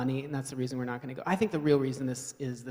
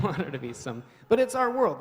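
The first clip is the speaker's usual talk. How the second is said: ordinary talk, nothing marked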